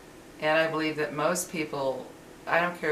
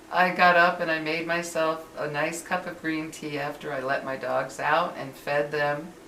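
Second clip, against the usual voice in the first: ordinary talk, nothing marked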